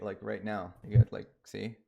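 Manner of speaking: usual speaking voice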